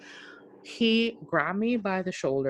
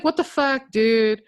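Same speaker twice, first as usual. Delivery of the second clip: higher, louder startled what the fuck; startled yell; 6 semitones higher, 7 dB louder than usual. Retold or imitated. Friend suddenly in her face, startled loud outburst. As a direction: jump back, shouting.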